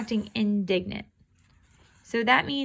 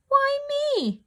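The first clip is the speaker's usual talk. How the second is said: mewed even higher, like a kitten; meowing. Acted. The cat wails as she is pulled out, a high mew. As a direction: wail it like a cat dragged out. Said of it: "I meowed".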